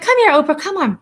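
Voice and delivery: high, sing-song coaxing of a dog; coaxing call. Retold or imitated. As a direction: coax the scared dog over, high and sweet. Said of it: "So I was like".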